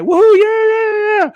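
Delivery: very high, loud whooping yeahs; squealed woohoo and yeahs; cheerleader whoop. Retold or imitated. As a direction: whoop like a pep rally squad. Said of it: "and the cheerleaders are".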